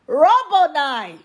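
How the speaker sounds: high, slow cry of recognition; Mary crying out to her teacher, Aramaic; cried out. Acted. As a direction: cry it out in joyful surprise. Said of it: "cried in Aramaic".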